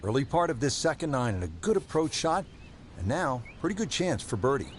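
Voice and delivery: calm live golf play-by-play; golf commentator. Acted. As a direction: call the shot like a TV golf broadcaster.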